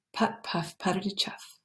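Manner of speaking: whispered soft engine sound, very quiet; faint far-off chuffing. A narrator's reading. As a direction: make the tractor sound faint and distant. Faint distant tractor sound.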